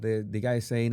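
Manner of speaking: ordinary talk, nothing marked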